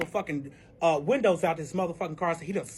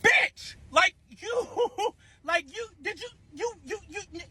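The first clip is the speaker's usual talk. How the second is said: high-pitched, stammering disbelief; high start, fading into stutters; sputtering outrage; 12 semitones higher than usual. Acted. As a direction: choke on your words, too indignant to finish. Sputters at being exposed publicly.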